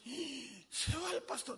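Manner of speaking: high, hushed mock gasp of alarm; acted-out dismay; shocked congregation. Congregation reacts to resignation, acted.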